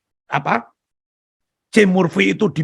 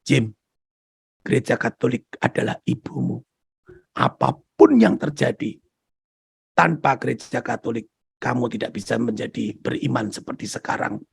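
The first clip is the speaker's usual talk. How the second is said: tender voice of Jesus; 6 semitones lower, 3 dB quieter than usual. Retold or imitated.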